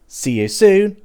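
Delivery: bright, loud farewell; cheery sign-off. Real, not acted. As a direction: call out goodbye happily.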